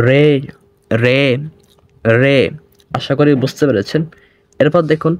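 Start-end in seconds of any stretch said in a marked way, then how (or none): 0.1-2.4 রে said heavy and thick, retroflex tap; a full, heavy রে three times. Shown as a demonstration. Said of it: "এটার উচ্চারণ একটু মোটা হবে".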